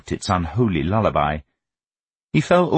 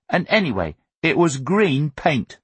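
put-on higher-pitched boyish retort; indignant boy; 8 semitones higher than usual. A narrator's reading. Son correcting his father's accusation, indignant. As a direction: argue the detail like an indignant child.

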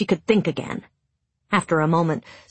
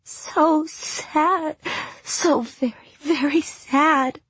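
slow, higher, tear-choked voice; tearful; 10 semitones higher, much slower than usual. A narrator's reading. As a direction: speak through tears, drawn out. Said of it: "words muffled by tears".

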